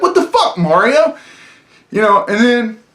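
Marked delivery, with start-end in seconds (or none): 0.0-1.2 mock disbelief. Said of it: "You're just like".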